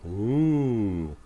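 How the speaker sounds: long wavering ghostly ooh; teasing sister doing a ghost howl; ghost wail. A narrator's reading. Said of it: "ghost-like wail".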